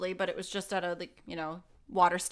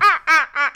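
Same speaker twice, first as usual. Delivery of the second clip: silly clown; 8 semitones higher, 13 dB louder than usual. Retold or imitated. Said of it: "Not, not so much that".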